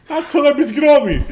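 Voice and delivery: fast quote, high and laughing; laughing while quoting. Retold or imitated. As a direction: quote him while cracking up. Quoting friend through laughter.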